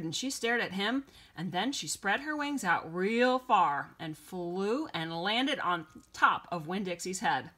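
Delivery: plain reading voice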